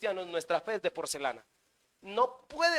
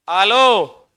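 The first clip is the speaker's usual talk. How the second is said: calling out; 15 dB louder than usual. Acted. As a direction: shout it like checking they're awake. Preacher calls to congregation.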